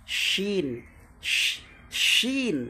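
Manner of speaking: শিন with a long hushing sh, pitched high; careful sheen demonstration. Shown as a demonstration. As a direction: stretch শিন as "shhh-eeen", high. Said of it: "আপনি ভালো করে উচ্চারণটা দেখেন".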